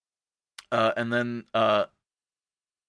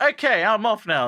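high, sudden chirp; mock breezy exit; 8 semitones higher, 4 dB louder than usual. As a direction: announce it high and abruptly. Student abruptly drops sick act.

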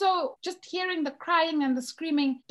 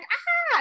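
mock scream; 13 semitones higher than usual. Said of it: "I go outside and I scream".

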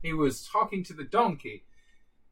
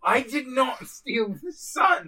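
slow, high protest; indignant denial; 8 semitones higher, slower than usual. Acted. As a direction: deny it with mock outrage. Denying in character.

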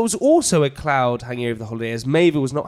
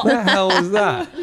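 cringing laugh; laughing disgust; 6 semitones higher than usual. Real, not acted. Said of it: "maybe shiver inside myself".

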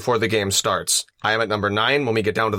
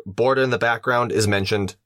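normal talking voice

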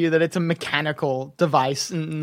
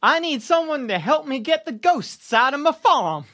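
eager gnome client; 7 semitones higher than usual. Acted.

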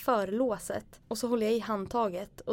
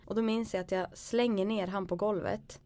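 normal talking voice

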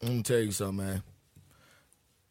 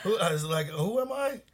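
stoned giggling; 7 semitones higher than usual. Real, not acted. High guy laughing at himself.

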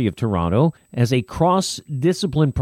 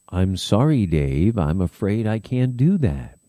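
low, monotone computer voice; HAL monotone voice; 6 semitones lower than usual. Retold or imitated. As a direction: recite it like a calm, flat machine. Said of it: "he said in that monotone voice".

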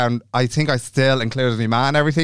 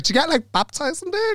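much higher, dopey innocent question; high naive voice; 3 dB quieter than usual. Retold or imitated. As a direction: play it clueless and squeaky. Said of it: "No, I was like".

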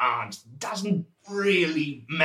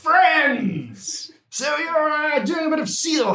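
hearty shout; 12 semitones higher, slower than usual. Acted. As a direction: call out cheerily to the seal hunters. Calling out to the hunters.

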